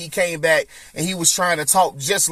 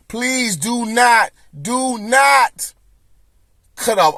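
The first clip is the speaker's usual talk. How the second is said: higher and louder, slow pleading; loud plea; 6 semitones higher, 3 dB louder, much slower than usual. Real, not acted. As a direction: beg the creator not to do it. Pleads directly to fifty.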